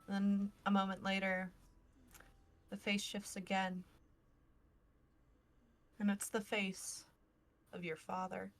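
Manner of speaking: normal talking voice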